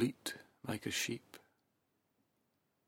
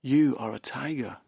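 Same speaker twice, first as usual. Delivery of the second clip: much louder, higher angry insistence; angry tiger; 4 semitones higher, 10 dB louder than usual. Acted. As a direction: shout the truth at the stubborn cub. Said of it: "getting angry now".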